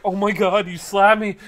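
high, loud "oh my God" gasping indignation; scandalized mock victim; mock shocked outrage. Mocking an outraged slapped lady.

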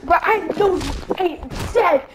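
ordinary talk, nothing marked

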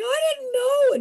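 high, loud, near-crying plea; imitating her own scared younger self; mock frightened plea. Girlfriend pleading with angry boyfriend, frightened. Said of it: "I was like".

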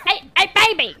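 loud, punchy playful holler; half-laughing mock scold; mock yelling at a baby. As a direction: pretend to yell at a baby. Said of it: "Like you're yelling at a baby".

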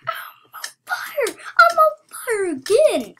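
loud, high-pitched crowing; excited boasting. Real, not acted. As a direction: shriek it in wild excitement. Boasting of winning streak.

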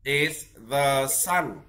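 drawn-out is the sun, word by word; slow model phrase. Shown as a demonstration. Teacher models a sentence for the child to copy, slowly. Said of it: "đọc theo thầy".

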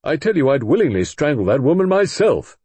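loud, high, furious rebuttal; indignant rage. A narrator's reading. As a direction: spit out his outrage at the murder. Roger rebuts Clemency angrily.

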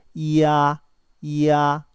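이야 loud and drawn out, 이 gliding into 아; pronunciation model. Shown as a demonstration. Teacher shows 이 joined with 아 as 이야, loud and held. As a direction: stretch 이야 on a level pitch. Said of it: "이하고 아가 결합됐네요".

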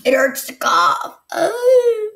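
loud pained complaint rising to a high moan; real hurt whine. Real, not acted. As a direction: cry out from a sore throat. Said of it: "I'm actually crying".

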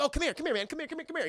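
high, rapid-fire mock beckoning; high coaxing. Klaw beckoning the last guard, high mock coaxing. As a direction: coax someone over with repeated little calls.

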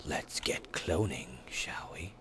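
soft unvoiced whisper. Shown as a demonstration. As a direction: breathe it out as a secret. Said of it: "All right, let's get cloning".

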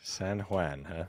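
ordinary delivery

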